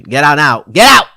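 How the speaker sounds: loud, high shout; mock shout. Officer sending the boy off, loud mock shout. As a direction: bark him out the door. Officer chasing kid away.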